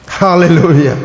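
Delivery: usual speaking voice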